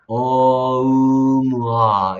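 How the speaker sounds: 어음아 drawn out very slowly, syllable by syllable. Shown as a demonstration. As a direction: stretch 어음아 out very slowly, each sound apart. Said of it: "이렇게 소리 내는 거야".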